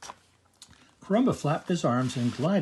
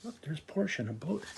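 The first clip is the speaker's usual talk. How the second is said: soft aside; 7 dB quieter than usual. Real, not acted. Aside to child.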